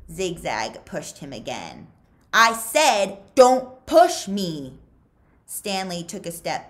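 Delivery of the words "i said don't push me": loud, slow furious yell; angry yell. Acted. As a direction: spit each word out. Zigzag escalating fight.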